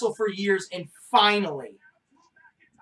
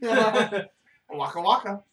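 Fozzie Bear voice; much slower than usual. Pretend. Comic bit.

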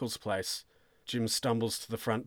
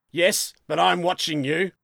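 loud, higher grudging threat; stern warning; 7 semitones higher, 7 dB louder than usual. Acted. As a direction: warn him he is under suspicion. Sergeant warns suspect.

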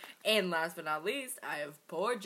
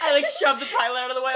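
fast, loud, laughing rush; excited rush; 4 semitones higher, 9 dB louder, much faster than usual. Pretend. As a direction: blurt it out excitedly.

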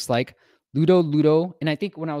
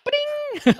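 high sung ba-ding chime; vocal rimshot bell after the joke; ding sound effect; 5 dB louder than usual. Retold or imitated. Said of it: "bad sound effects".

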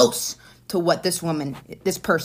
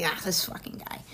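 breathy annoyed under-the-breath whisper, much softer; 9 dB quieter than usual. Retold or imitated. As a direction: mutter it to yourself, fed up. Annoyed aside about a colleague.

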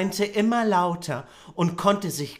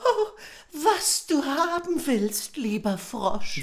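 very high, sweet-pleading voice; pleading princess; 10 semitones higher than usual. Acted. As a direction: beg the frog in a squeaky princess voice.

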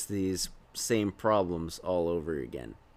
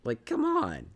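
exasperated. Real, not acted. Exasperated complaint, very high.

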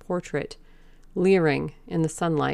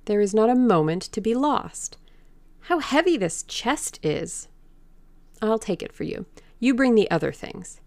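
rapid, authoritative; 5 semitones higher than usual. A narrator's reading. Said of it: "He spoke rapidly and in an authoritative manner".

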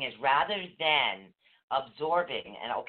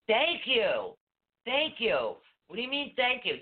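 mock delight; 9 semitones higher than usual. Retold reply to a mean survey.

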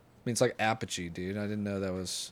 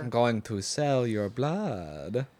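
slow, hammed-up vampire voice; mock vampire; 5 dB quieter, much slower than usual. Teasing the movie scene with a slow, campy vampire voice.

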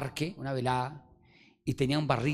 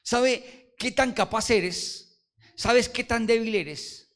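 high, loud, slow preaching; 9 semitones higher, 7 dB louder, slower than usual. Acted. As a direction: preach it slowly from the pulpit. Preaching to congregation.

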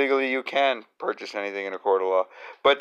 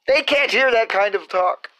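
mock scandalized; 11 semitones higher, 3 dB louder than usual.

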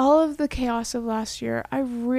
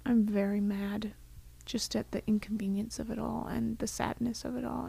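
hushed, voiceless plea to God; whispered prayer; 6 dB quieter than usual. Retold or imitated. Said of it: "I was like".

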